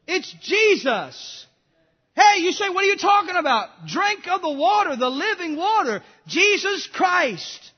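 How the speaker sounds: high, loud preacher's call; fiery preaching. Acted. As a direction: call it out to the whole church. Preaching at full force.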